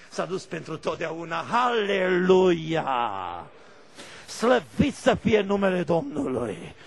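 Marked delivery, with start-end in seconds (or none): 1.4-6.7 very slow, loud drawn-out hallelujah; preaching cry. Acted. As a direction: stretch it out to the congregation. Preacher's exclamation.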